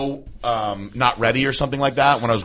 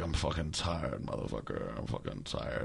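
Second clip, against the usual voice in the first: much lower, softer grumbling swear-muttering; grumpy mutter to himself; 10 semitones lower, 20 dB quieter, slower than usual. Retold or imitated. Alone in the kitchen, cursing at nobody, low tired grumble. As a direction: growl it low to yourself, worn out. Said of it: "I was going".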